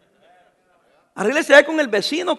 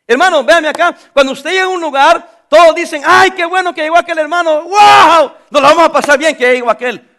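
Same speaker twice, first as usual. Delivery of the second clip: excited welcome; 6 semitones higher, 8 dB louder than usual. Acted. Preacher acts out happy welcome.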